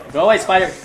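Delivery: high, loud order to go away; shooing cry. Real, not acted. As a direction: call out to drive off a pest. Shooing a spider in the game.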